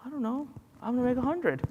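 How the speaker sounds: soft, high-pitched noncommittal reply; offhand reply. Retold or imitated. Answering a doubting college friend, offhand and high. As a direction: answer lightly in a high, small voice.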